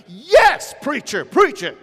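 "Yes" flung out high and loud; mock church shout. The congregation shouting back at the speaker, loud and high. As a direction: holler like an amen corner. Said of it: "they're like".

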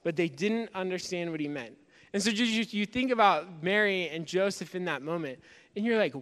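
usual speaking voice

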